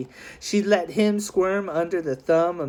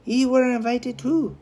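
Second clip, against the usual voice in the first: Missus O'Neill; 8 semitones higher than usual. A narrator's reading. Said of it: "said Missus O'Neill".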